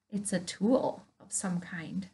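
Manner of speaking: put-on secretive whisper to the children; hushed, teasing mystery whisper. Teasing a mystery tool to kids.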